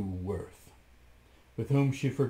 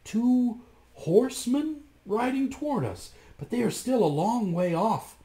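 much higher, eager; excited sister; 11 semitones higher than usual. A narrator's reading. Sister Anne spotting horsemen, eager. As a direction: report it with hope. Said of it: "she replied".

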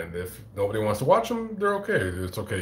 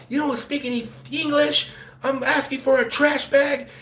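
mock angry rant. Homeless man ranting at Taco Bell staff, high indignant yell. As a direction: shriek it like an outraged customer. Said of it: "this guy kinda goes on a rant".

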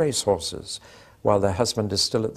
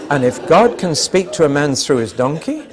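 louder, laughing through the words; 5 semitones higher, 8 dB louder than usual. Real, not acted. Preacher laughing at his donkey joke, loud laughing voice. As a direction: chuckle the punchline out loud.

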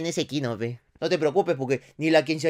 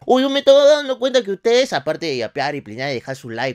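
ordinary talk, nothing marked

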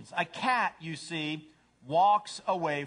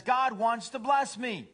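high, drawn-out cat boast; self-centered cat; 7 semitones higher, 4 dB louder, slower than usual. Acted. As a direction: crow it slowly, pitched up. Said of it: "A cat says".

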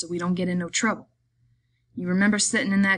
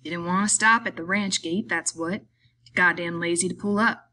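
plain reading voice

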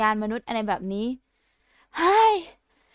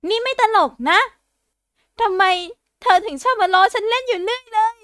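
upset complaint; 6 semitones higher, 4 dB louder than usual. Acted. Zombie scolds teasing sister.

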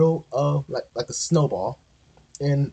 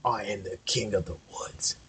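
snarling wolf; 5 semitones lower, 9 dB quieter than usual. A narrator's reading. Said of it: "snarled the wolf".

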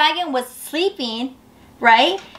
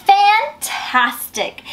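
drawn-out, higher "Fantastic"; delighted; 7 semitones higher, much slower than usual. Acted. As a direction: savor the word slowly. Elizabeth's delighted exclamation.

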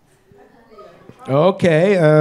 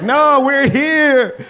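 high, slow, eager "we're here!"; overjoyed newcomer, drawn out and high; mock foreigner; 10 semitones higher, 3 dB louder, much slower than usual. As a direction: stretch it out high and thrilled like a happy immigrant. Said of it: "They're like".

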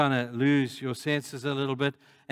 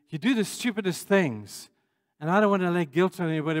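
usual speaking voice

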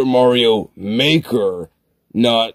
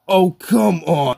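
drawn-out, high-pitched groan of protest; exasperated gamer complaint; 7 semitones higher than usual. Acted. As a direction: whine it in frustration, pitched up. Misses a mushroom in the game.

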